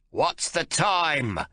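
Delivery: put-on high, slow old man's demand; impatient old father; cranky old man. A narrator's reading. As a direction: snap at your son like a crotchety elder. Old man voiced in story.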